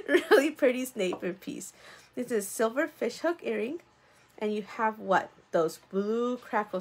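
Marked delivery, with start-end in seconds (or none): 0.1-1.7 louder, still laughing on the words; laughing through it. Real, not acted. Seller recovers from her accent slip, still laughing. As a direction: get the line out while still giggling.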